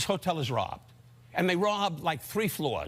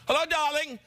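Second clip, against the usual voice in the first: imitating his mother; 12 semitones higher than usual. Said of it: "My mother, God rest her soul".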